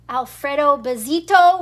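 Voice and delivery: plain reading voice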